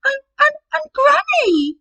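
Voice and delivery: very high, loud frightened stammer; stammering Red. Acted. Red questioning the disguised wolf, high scared stammer. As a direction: stutter in fear as Red.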